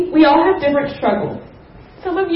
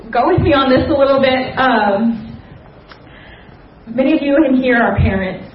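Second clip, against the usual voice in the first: ordinary talk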